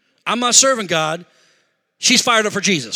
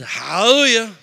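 louder but held back, retold; dutiful repeat; 5 dB louder than usual. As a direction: say it because you were told to. Reluctant first repeat after wife.

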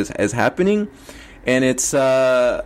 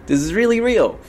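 higher-pitched repeat, stressing REALLY; pinch-yourself disbelief, pitched up; incredulous realization; 8 semitones higher than usual. Retold or imitated. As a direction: let disbelief lift the pitch on "really real". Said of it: "am pinching myself".

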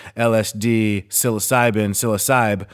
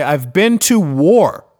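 higher, emphatic mock lecture; indignant rebuke; 7 semitones higher, 3 dB louder than usual. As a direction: put them in their place, pitched up and exasperated. Acted rebuke to stressed coworkers.

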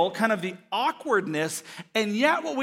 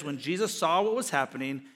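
normal talking voice

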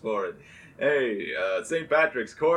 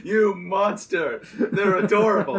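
mock outrage; 5 semitones higher, 5 dB louder than usual. Mock outrage over mongooses.

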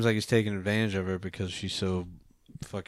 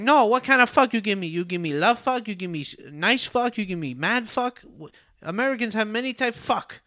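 much higher mock broken English, hammed up; imitating the girl; 11 semitones higher than usual. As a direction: rattle off the questions in her high put-on voice. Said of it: "She's like, she's like".

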